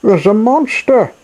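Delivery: high, loud little bird voices warning him; birds calling out. Acted. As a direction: squeak out a frantic warning like small birds. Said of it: "the birds and small creatures called out".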